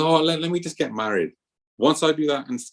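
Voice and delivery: mild mock pleading excuse; pleading disciple.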